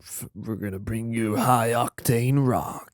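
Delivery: slow, hyped rock station announcer; rock radio DJ. Retold or imitated. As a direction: sell the rock like a DJ.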